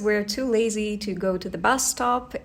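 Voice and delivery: normal talking voice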